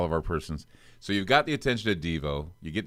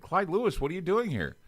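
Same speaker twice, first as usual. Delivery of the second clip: higher, startled call of a name; a mild mock surprise, pitched up; surprised greeting; 11 semitones higher than usual. Old acquaintance spotting him in a hotel, higher mock surprise.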